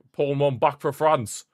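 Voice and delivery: ordinary talk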